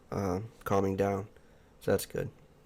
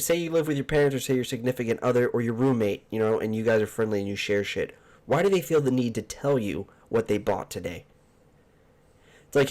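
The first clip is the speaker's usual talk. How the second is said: ordinary talk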